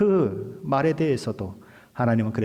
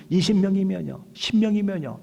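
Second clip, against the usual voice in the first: high-pitched acted haggling; bargaining plea; 8 semitones higher than usual. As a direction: keep pressing with each smaller offer. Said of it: "라고 따지는 거예요". (높은 목소리로 흥정하듯 묻기)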